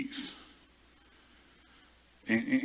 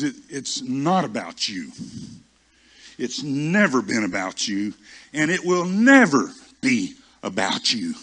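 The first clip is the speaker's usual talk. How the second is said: slow, emphatic rebuke, building louder; real serious; 7 dB louder than usual. Retold or imitated. Holy Spirit correcting the preacher, real serious and emphatic. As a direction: lay it down slowly, hammering NEVER. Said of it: "it got real serious".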